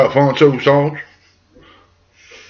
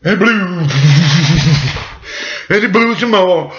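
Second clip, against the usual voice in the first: higher, rising storyteller build-up; dramatic huffing-and-puffing; 5 semitones higher than usual. A narrator's reading. Storyteller shows wolf blowing at the house, dramatic. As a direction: belt out the blowing with big breaths.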